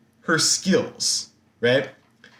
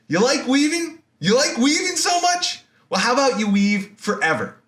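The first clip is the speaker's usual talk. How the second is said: high, sneering taunt, rising; mock her cruelly, pitch climbing; mocking Athena; 10 semitones higher than usual. Athena taunting Arachne before cursing her, high sneer. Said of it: "she says".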